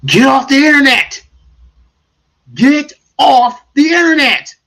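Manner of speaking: loud, high rant; shouting. Real, not acted. Streamer shouting advice to viewers. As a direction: yell it at the dating crowd.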